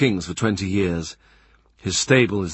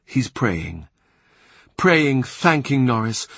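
usual speaking voice

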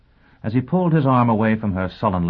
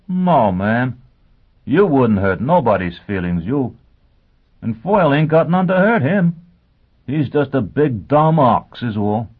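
loud, emphatic sergeant, higher pitched; reassuring sergeant; 6 semitones higher, 6 dB louder than usual. Acted. Sergeant reassuring his teacher about Foyle, loud and blunt. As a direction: punch it out as a blunt, loyal sergeant.

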